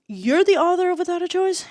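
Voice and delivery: high, loud and amazed; a fan suddenly spotting the author; surprised squeal. Retold or imitated. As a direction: blurt it out in disbelief. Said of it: "one of the girls was like".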